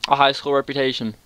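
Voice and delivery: usual speaking voice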